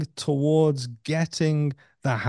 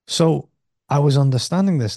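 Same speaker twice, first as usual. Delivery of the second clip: ordinary talk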